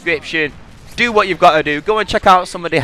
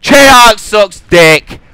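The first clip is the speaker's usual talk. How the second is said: louder, higher taunting brag, slow at the end; loud trash talk; 6 semitones higher, 9 dB louder, slower than usual. Real, not acted. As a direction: taunt the fanboys at full voice. Trash-talking rival players.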